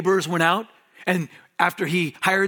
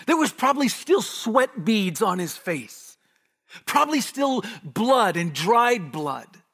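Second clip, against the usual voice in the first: higher, louder preaching at full force; impassioned preaching; 7 semitones higher, 4 dB louder than usual. Real, not acted. Pastor pleads with the congregation, impassioned. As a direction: preach it with building intensity. Preacher builds to emotional climax.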